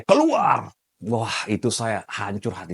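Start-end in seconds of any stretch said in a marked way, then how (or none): 0.0-0.7 high, sharp cursing shout; teacher cursing him out; retold angry yell. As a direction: bark the order out. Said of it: "memaki saya".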